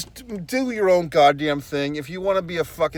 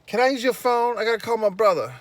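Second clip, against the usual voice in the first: higher-pitched mimicry of her request; imitating young woman; 7 semitones higher, faster than usual. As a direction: put on a stranger's pleading ask, pitched up. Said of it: "She's like".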